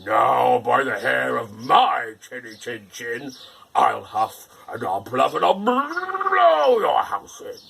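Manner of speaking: slow, loud, menacing wolf threat; furious wolf at the brick house. Acted. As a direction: spit the vow out in rage. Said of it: "made the wolf perfectly furious".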